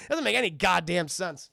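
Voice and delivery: ordinary talk, nothing marked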